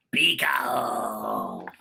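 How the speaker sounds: low, drawn-out creature call; Beagle's voice. Acted. Beagle answering Alice, slow low creature voice. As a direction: stretch the name, low and odd. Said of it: "That's how I imagine Beagle sound".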